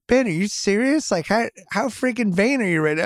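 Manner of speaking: exasperated teasing scold; a touch of mock outrage at the vain son; incredulous parents.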